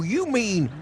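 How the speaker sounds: ordinary talk, nothing marked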